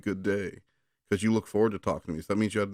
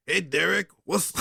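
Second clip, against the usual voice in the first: higher voice, different timbre; put-on character voice; 9 semitones higher, 3 dB louder than usual. Brother trying a voice for Aaron in the story. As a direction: try a silly character voice. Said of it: "maybe I shouldn't do that voice".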